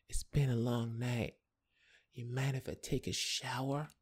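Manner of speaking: soft, lifted, unhurried woman's voice; hushed flirty request; seductive woman. Retold or imitated. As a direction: voice her quietly and suggestively. Retold seductive woman speaking.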